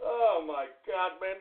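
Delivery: usual speaking voice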